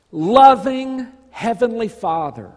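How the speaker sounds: normal talking voice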